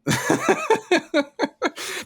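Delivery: real high-pitched laughing. Real, not acted. Laughs at the Brie debate.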